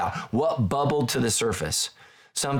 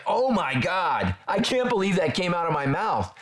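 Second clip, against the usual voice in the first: slow, higher-pitched stunned exclamation; retold shock; 6 semitones higher than usual. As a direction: relive your shock, drawing out the opening words. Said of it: "And I was shocked".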